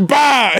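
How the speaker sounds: high explosive shout; a cartoonish boom; mock blast. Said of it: "it'd just be like".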